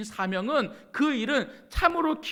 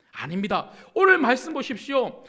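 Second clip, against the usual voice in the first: normal talking voice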